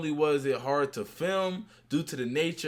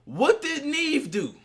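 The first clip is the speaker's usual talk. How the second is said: much higher, louder disbelief; baffled question; 7 semitones higher, 4 dB louder than usual. Real, not acted. Host asking the co-host, baffled and incredulous. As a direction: demand an answer, astonished.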